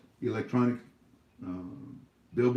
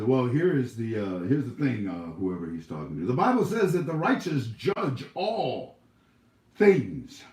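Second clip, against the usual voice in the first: mocking critic; 2 semitones higher, 4 dB louder than usual. Vlogger mimicking a critic who quotes the Bible. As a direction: sneer it out as the know-it-all critic. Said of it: "They say".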